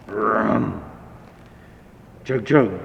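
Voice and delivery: chugging engine sounds; engine noises. Acted. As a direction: sound out the old engine starting. Engine coughing to life.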